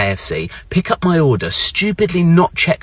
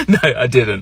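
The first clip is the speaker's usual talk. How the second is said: usual speaking voice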